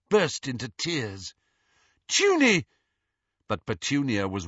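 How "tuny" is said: high, loud frightened call; alarmed cry. Acted. Lily cries to her sister struck by a branch, high and loud.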